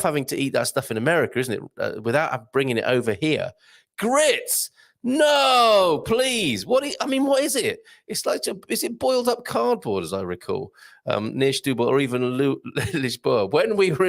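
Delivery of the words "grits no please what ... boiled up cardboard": mock disgust. Mock horror at grits request.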